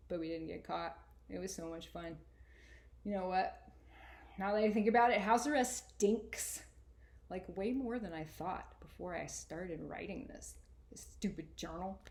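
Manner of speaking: put-on lively first-person reading of a boy's journal; story reading. A narrator's reading. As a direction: read it like a kid talking straight to you. Author reads her novel aloud.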